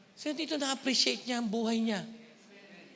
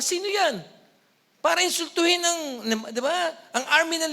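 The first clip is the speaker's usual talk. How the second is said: louder, higher indignant demand; indignant David; 6 semitones higher, 7 dB louder than usual. Retold or imitated. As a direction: demand it like an insulted young David. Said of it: "ang sabi ni David".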